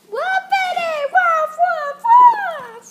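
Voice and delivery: very loud, high, slow wailing woofs. Acted. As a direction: wail the woofs out. Owner shouts dog talk.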